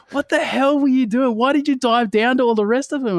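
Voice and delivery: high, loud, baffled questioning; incredulous friend. Retold or imitated. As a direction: play the friend who can't believe it. Rabbit questioning him.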